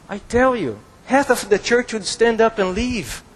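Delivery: ordinary talk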